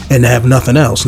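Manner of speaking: ordinary talk, nothing marked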